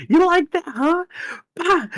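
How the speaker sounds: very high mocking taunt; squeaky taunt. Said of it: "I'll be Doctor Lingham".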